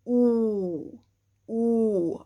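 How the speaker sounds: "oo" slow and round from the jaw; rounder vowel. Shown as a demonstration. Teacher showing the rounder vowel, slowly. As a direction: stretch a round "oo". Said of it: "a rounder sound".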